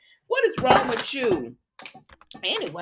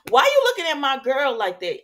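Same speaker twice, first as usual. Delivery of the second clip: mock confrontation; 4 semitones higher, 6 dB louder than usual. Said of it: "'Cause she my girl right now".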